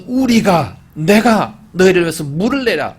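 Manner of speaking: proud, loud retort stressing 내가; angry shout. Acted. Moses angrily shouting at the people while striking the rock. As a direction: shout it in arrogant anger. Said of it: "소리치면서 화를 내면서".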